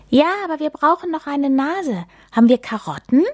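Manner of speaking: a hint of a delighted child; lightly voiced child. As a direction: play the excited small boy. Child Theo voiced in story.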